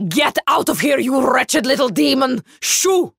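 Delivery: put-on high furious shout at the boy; angry shout. Mirella screaming at the sobbing boy to leave, furious shout. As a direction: yell him out of the tent.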